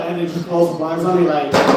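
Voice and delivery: ordinary delivery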